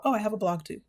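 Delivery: ordinary talk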